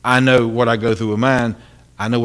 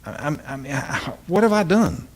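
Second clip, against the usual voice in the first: higher, pleading, hurt lament; Jesus hurt; 7 semitones higher than usual. Retold or imitated. Jesus looking down, pleading with Preston, hurt. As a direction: plead like a hurt friend who asks what he did wrong. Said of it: "he'd say".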